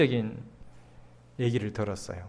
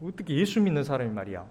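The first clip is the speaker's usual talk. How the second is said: ordinary delivery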